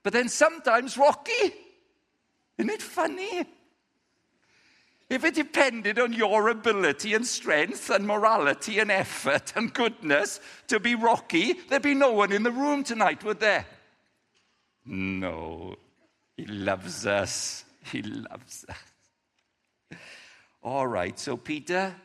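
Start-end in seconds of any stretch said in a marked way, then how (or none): 0.0-13.6 higher, louder preaching push; building emphasis. Real, not acted. Preacher rousing the room, high and loud. 14.9-18.8 tender reassurance. Real, not acted. Preacher reassuring the congregation, low and tender.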